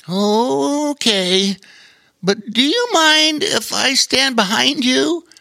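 high, timid little otter voice; nervous otter hiding behind his big friend; worried otter. A narrator's reading. Otter asks to hide behind the bear, high and timid. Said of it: "said the worried otter".

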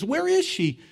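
put-on very high child's voice, puzzled; a small boy stopping to ask; little boy asking. The son turns around looking for mom, very high. Boy stops and asks again.

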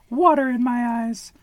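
very high little duck voice, sulking; whiny duckling. A narrator's reading. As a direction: squeak it out like a grumpy duckling. Baby Duck complains.

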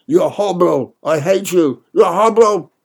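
loud, high defiant yell; scared but standing your ground; terrified but resolute. A narrator's reading. Boy defying the bad mother, frightened yell. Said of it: "I fling back, terrified but resolute".

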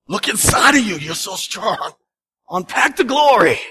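a hint of a flattering worldly voice. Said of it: "What does the world teach you?".

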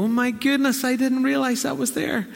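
high, wondering "oh my goodness"; amazed realization. Retold or imitated. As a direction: gasp at a discovery. Imagined realization of the listener.

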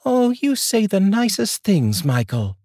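high, slow female voice; wife coyly teasing her husband. Acted. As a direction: coo it back at him, playfully.

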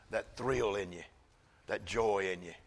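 normal talking voice

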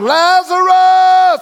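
very high, slow ringing shout of Lazarus; loud call. Acted. Jesus calling Lazarus into the depths, slow ringing shout. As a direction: stretch the name out into the depths. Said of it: "He said in a loud voice".

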